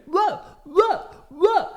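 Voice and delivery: very high, drawn-out whoa; astonished whoa. Retold or imitated. At work, watching cops race by, high astonished whoa. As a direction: gape at the passing cars. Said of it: "I'm sitting like".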